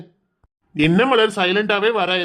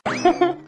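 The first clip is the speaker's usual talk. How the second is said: amused chuckle; 7 semitones higher than usual. Acted. Husband laughs at her crying.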